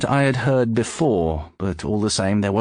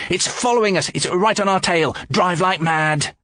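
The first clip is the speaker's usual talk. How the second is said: much higher, urgent cries; alarmed urging; 10 semitones higher than usual. Acted. George urges the driver to flee, high and alarmed. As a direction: hurry the driver. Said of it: "George shouted".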